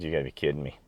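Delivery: hearing from his wife that the pet bird died; hushed, deflated disbelief; stunned grief. Retold or imitated. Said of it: "I said".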